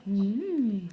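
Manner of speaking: ordinary talk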